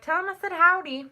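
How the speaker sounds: high, loud Southern send-off; imitated Southern accent. Imitating Southern relatives passing on greetings, high Southern twang. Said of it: "a slight southern accent".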